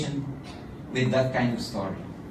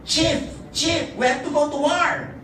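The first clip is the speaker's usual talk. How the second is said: high urgent warrior's cry; breathless messenger calling the chief; right-hand man's call; 13 semitones higher, 4 dB louder than usual. Acted. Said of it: "his right-hand man approached and said".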